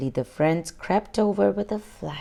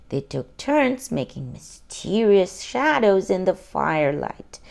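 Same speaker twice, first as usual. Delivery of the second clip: plain reading voice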